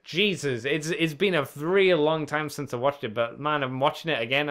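usual speaking voice

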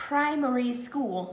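ordinary talk, nothing marked

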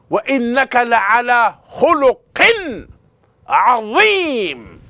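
loud, high, emphatic recitation; excited recitation. Shown as a demonstration. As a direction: recite with ringing excitement, the last word drawn out long. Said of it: "Did you hear the excitement in my voice?".

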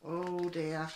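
ordinary delivery, nothing marked

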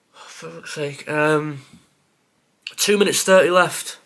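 ordinary delivery

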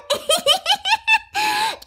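long, very high squealing laugh; high giggle. Acted. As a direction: cackle away in a squeaky pitch. Giggles after the line.

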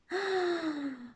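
soft, high, drawn-out "ah"; a quiet gasp of wonder; murmured "ah". A narrator's reading. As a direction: breathe out a small, high "ah". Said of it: "mormorò Misurina".

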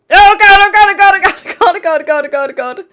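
high, loud panic, fading down; gasping terror; panicked oh God. Real, not acted. Chased by angry pigmen, real panic, high then fading.

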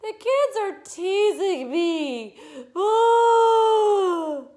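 high, slow, loud tearful wail; hammed-up crybaby wail breaking into boo-hoo; bawling. A narrator's reading. Teased girl complaining to mother, hammed-up bawling. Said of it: "she bawled".

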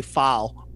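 "file" said as "fahl"; Kentucky vowel. Shown as a demonstration. Showing coworkers' catch, "file" said "fahl" in Kentucky accent. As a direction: flatten the vowel in "file" to a long "ah". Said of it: "I say file".

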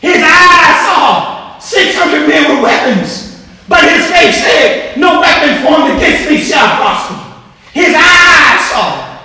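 full-force gospel preaching; fiery preaching. Acted. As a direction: preach it out to the congregation. Preaching the Shamgar story.

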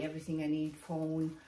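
ordinary delivery

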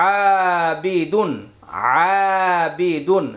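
আবিদুন with a very long first আ; slow recitation, holding the আ of আবিদুন; madd stretch. Shown as a demonstration. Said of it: "একটি করে মাদের হরফ পাবো".

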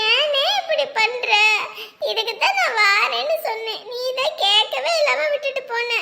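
high, plaintive scolding; upset reproach. Acted. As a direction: reproach a big brother out of worry, high-voiced. Sister scolds brother out of worry. (கவலையோடு உயர்ந்த குரலில் கடிந்து)

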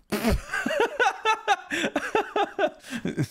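a burst of high real laughter. Real, not acted. Streamer bursting out laughing at his own joke. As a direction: crack up for real.

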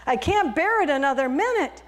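trapped teacup begging; pleading for help. Acted. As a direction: beg to be let out. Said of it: "banging on the door and I said".

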